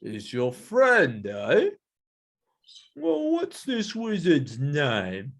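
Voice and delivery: very slow, drawn-out sceptical gorilla; put-on creature voice, wary and testing; suspicious gorilla. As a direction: question them slowly, not buying it. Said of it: "start getting a bit suspicious".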